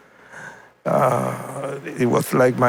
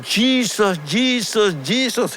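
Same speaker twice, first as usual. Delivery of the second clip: high-pitched cry, no real shout; retold loud cry; 8 semitones higher than usual. Friend retelling a mute man's outburst, high retold cry. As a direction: call the name out high, each one falling. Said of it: "The only word that he said loud".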